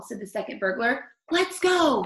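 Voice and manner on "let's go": excited. Acted. Burglars escaping.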